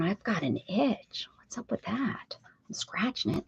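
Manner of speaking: soft unvoiced whisper. Real, not acted. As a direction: whisper it to yourself. Whispered aside about an itch.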